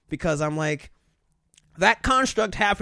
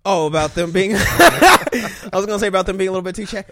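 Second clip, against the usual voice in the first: talking through real laughter; laughing while speaking, louder; giggling reply; 8 dB louder than usual. Real, not acted. Laughing at friend's remark.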